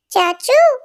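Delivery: put-on high, chirpy little boy tattling on his aunt; small child voice. Little boy teasing his aunt in a childlike voice. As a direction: play a cheeky little kid showing off.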